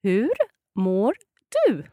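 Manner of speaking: Hur mår du with a pause after Hur; slow and clear. Shown as a demonstration. Teacher says it slowly for the learner, clearly. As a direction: lay it out slowly, word by word. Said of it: "let's hear it slowly".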